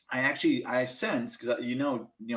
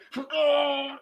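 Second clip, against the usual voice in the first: pained laugh; 5 dB louder than usual. Real, not acted. Laughing about sitting on bite.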